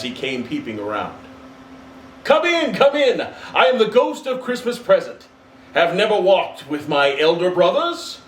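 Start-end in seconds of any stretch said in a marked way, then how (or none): 2.2-8.2 big, high, loud hearty spirit; jovial giant spirit calling out; booming jolly ghost. Acted. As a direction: welcome him in with a giant's glee. Ghost of Christmas Present speaks.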